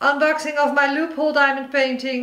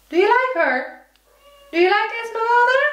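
much higher, quick cooing question; pet talk to cats; 10 semitones higher, faster than usual. Real, not acted. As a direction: chirp it at the kittens, fast and high. Asking the cats.

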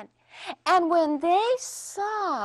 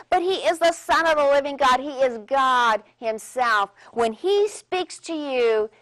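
voice of God; 5 dB louder than usual. Acted. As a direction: proclaim it like God speaking from heaven. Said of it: "And that voice said".

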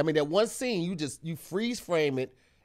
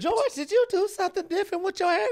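high, impressed woman's voice. Retold or imitated. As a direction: voice a woman noticing you. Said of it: "she gonna be like".

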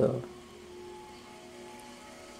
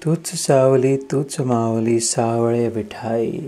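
drawn-out, imploring abhang recitation; prayerful recitation, lingering on line endings; devotional prayer in verse; slower than usual. Acted. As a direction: address the deity in measured verse.

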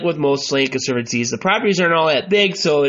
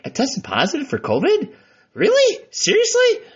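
high incredulous surprise; shocked, pitch jumping up; disbelieving; 10 semitones higher than usual. Retold or imitated. Retelling disbelief at covid test.

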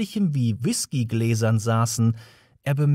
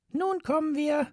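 high, tiny mouse voice; a mouse getting to business; businesslike mouse; 4 dB quieter than usual. A narrator's reading.

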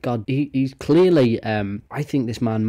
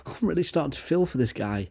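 ordinary delivery

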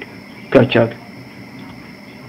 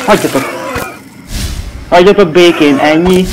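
frustrated shout; 4 semitones higher than usual. Real, not acted. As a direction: yell at the monsters to back off. Monsters chasing, frustrated player. (dühös kiabálás)

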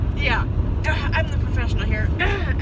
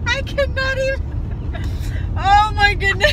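laughing; 3 dB louder than usual. Real, not acted. Can't stop laughing.

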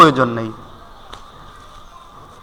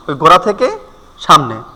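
normal talking voice

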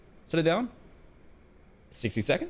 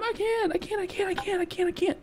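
panicked; 12 semitones higher, 3 dB quieter than usual. Real, not acted. Two orders at once, panicking.

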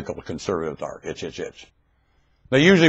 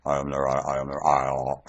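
much lower and softer, another self speaking; puka voice; 9 semitones lower, 8 dB quieter than usual. Pretend. Puka answering the prompt, low and soft. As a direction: drop low and soft as the inner voice. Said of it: "That tone of voice, that's puka".

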